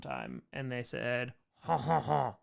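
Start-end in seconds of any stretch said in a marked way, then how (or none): 1.6-2.4 mock French hello. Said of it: "that's how they said hello".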